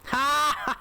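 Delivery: high, loud burst of exclamation; sudden high shout of "ha". Real, not acted. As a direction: punch out one loud, high cry. Exclaims during air combat.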